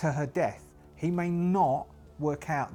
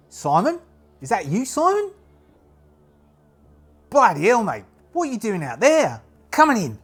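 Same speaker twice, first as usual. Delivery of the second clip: loud, high Australian shout calling out to him; Australian lookout shouting to a returning friend in a broad Australian accent; 8 semitones higher, 9 dB louder than usual. Acted. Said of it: "I've got to try and do an Australian accent".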